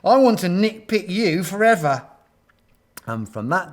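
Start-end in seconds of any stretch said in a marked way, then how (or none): 0.0-2.1 louder, higher gushing; admiring. A narrator's reading. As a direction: declare it like a besotted fan. Gorillas swoon over hero Gordon.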